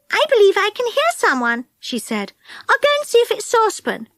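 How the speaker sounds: put-on Connie's bright girl voice. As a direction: speak up high like a keen little girl.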